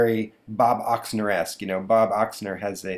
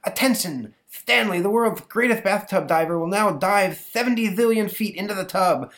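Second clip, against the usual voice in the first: much higher boy playing announcer; kid announcer; 11 semitones higher, 4 dB louder than usual. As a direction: announce the dive like a circus act. Boy announces his dive.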